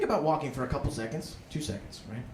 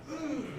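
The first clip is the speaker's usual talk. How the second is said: high, soft lip-smack hum; a slow lip-lick hum at a stranger; 10 semitones higher, 10 dB quieter than usual. Acted. [name] smacking his lips at a passerby, high soft hum. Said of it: "I'm like".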